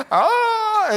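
very high, held "ahh" of wonder; hammed-up awestruck squeal; awed gasp. Acts out awe turning the corner.

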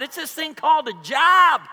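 high, loud punch line; exasperated retort. Retold or imitated. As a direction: belt out the obvious answer. Exasperated retort to the young guys.

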